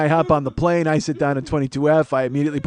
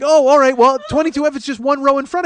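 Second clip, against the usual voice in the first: amused agent, high; 8 semitones higher, 4 dB louder than usual. Retold or imitated. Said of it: "And she's like".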